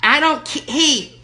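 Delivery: high, loud irate lady caller; Erkenbacher. Acted. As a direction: play the furious entitled lady on the phone. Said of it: "Erkenbacher calling back".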